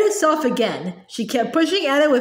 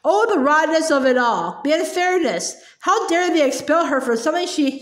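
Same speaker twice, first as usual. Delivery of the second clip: ordinary delivery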